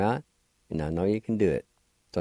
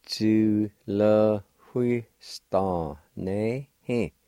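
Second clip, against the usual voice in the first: Tu le hui sta ne he, each syllable slow; much slower than usual. Shown as a demonstration. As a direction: spell it out slowly. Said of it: "And together".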